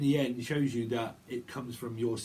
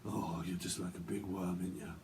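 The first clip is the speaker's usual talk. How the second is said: low, hushed character voice; creepy TV character impression; 5 semitones lower, 7 dB quieter than usual. Quoting the guy with glasses from Afterlife, low creepy impression. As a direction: drop low and soft, imitating an odd man. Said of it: "that guy with the glasses".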